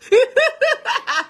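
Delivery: a sudden loud high laugh; high burst of laughter. Real, not acted. Host bursting out laughing at her kids' old gifts.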